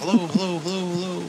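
repeated word fading away softly; imitated echo. Shown as a demonstration. Hello returning from forty yards away, fading echo imitation. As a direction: bounce it back like a distant echo, dying off. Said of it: "and then you hear it from, like, forty yards away".

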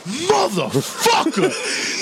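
drawn-out, high-pitched mock outburst; retold furious curse. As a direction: stretch the swear out, imitating an exasperated friend. Retelling friend's furious outburst.

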